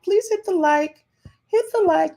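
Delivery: asking new viewers to like, high and cute; cute pleading. Real, not acted. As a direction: coax them sweetly.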